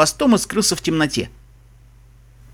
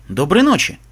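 ordinary delivery

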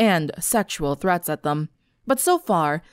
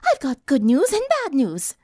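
refined lady voice; 6 semitones higher than usual. A narrator's reading. Rarity voiced.

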